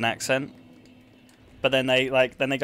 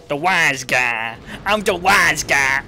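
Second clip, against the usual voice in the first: put-on slow, drawn-out American wise-guy accent, building louder; 5 dB louder, slower than usual. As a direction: stretch it out like an American mobster accent. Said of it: "Where's that accent from in America".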